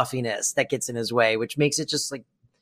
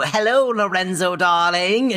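lightly hammed-up flirty greeting; higher, drawn-out greeting to a darling; 3 semitones higher than usual.

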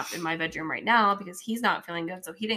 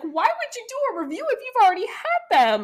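put-on much higher, louder protesting boy; imitating an indignant kid; 7 dB louder than usual. Mom mimics her son protesting the latte review, high indignant kid voice. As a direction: mimic a baffled child. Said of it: "He's like".

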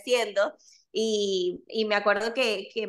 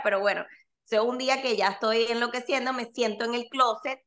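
normal talking voice

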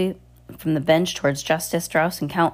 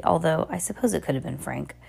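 ordinary delivery, nothing marked

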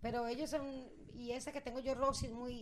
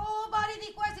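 high, louder excited retelling; mock astonishment; 9 semitones higher, 5 dB louder than usual. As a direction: exclaim it like big news. Excited retelling of household reactions.

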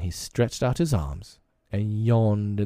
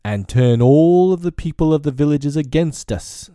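loud, higher furious snarl; 7 semitones higher, 8 dB louder than usual. Acted. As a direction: snarl it at the whole council. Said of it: "snarled Shere Khan".

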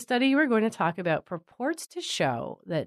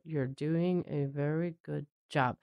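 slow, softer, deadpan praise; neutral tone; 3 semitones lower, 6 dB quieter than usual. Retold or imitated. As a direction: imitate an owner praising neutrally, slowly and quietly. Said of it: "and in a neutral tone".

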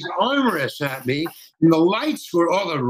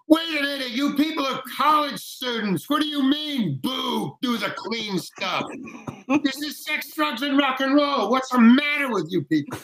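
high-pitched indignant yell, held back in volume; mock shouting; 9 semitones higher, 3 dB quieter than usual. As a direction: retell telling off a whole crowd, voice pitched way up. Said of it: "so I shouted at these".

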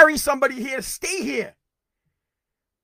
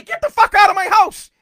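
furious shout; 9 semitones higher, 9 dB louder than usual. Acted. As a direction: yell it high and loud at the intruders. Telling intruders to leave.

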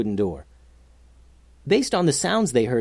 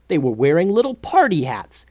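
usual speaking voice

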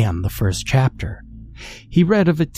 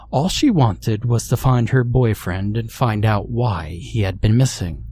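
ordinary delivery

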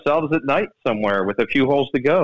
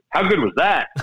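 Tom Watson voice; 4 semitones higher than usual. Retold or imitated. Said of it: "he looks at me and goes".

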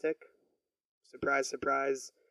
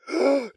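gasp; 9 semitones higher, 5 dB louder than usual. Real, not acted. Shocked by what he reads.

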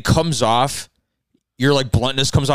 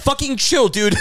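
squeaky high exasperated "chill, dude"; mock chill-out plea; 11 semitones higher than usual. Friends telling a cocky Gabe to chill, high mock plea. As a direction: pitch way up, pleading with a cocky friend. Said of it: "And everyone's like".

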